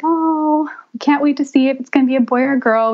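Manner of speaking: mildly giddy retelling of a couple's eagerness; excited anticipation. Retold or imitated. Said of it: "We were so excited".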